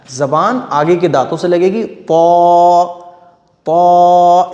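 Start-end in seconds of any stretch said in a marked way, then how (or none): 1.8-2.8 "पौ" as a heavy emphatic T with long rounded vowel; thick-sound demo. Shown as a demonstration. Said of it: "ये मोटा है". 3.7-4.5 "पौ" as a heavy emphatic T with long rounded vowel; thick-sound demo. Shown as a demonstration. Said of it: "इस तरह से".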